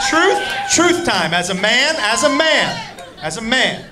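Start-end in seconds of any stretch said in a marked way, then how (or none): 0.1-1.3 slow emphatic truth time; dramatic announcement. Real, not acted. Comedian announcing truth time to the crowd, slow emphatic. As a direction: drag it out like a show announcer. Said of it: "let's get into a little truth time".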